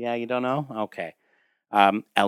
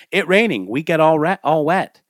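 put-on higher, louder little girl voice; a hint of a whiny little girl; little girl Lana; 5 semitones higher, 5 dB louder than usual. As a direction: pipe up like a small child complaining.

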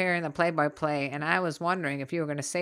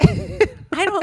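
mocking squeal; 8 dB louder than usual. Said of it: "keeps mocking the offer".

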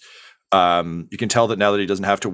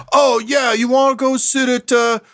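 very high, loud chef blurting a secret; no low volume; 4 dB louder, slower than usual. Acted. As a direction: announce it to the whole room, no volume control. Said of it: "there's no low volume".

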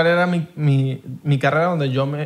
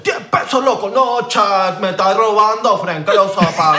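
indignant haggler, raised pitch; mock outrage; 3 semitones higher, slower than usual. Acting out haggling with a Panama taxi driver, mock outrage. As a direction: protest the fare with hammed-up outrage.

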